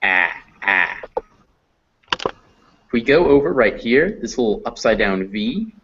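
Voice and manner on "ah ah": "Ah" as in cat, tongue low; open front a. Shown as a demonstration. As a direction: repeat "Ah" with the jaw dropped, separate and held. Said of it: "tongue near the bottom of your mouth".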